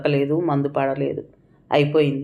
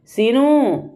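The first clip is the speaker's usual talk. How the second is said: a higher, louder wail of the name; mother's cry of grief over her son; 8 semitones higher, 3 dB louder, much slower than usual. A narrator's reading. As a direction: cry out the name, breaking into sobs. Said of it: "ఘొల్లుమంటూ ఏడ్చిన ఏడుపు".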